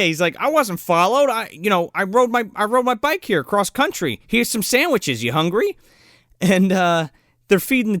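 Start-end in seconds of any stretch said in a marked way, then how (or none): 0.4-5.8 mock naive guy. Mocking Byron quote.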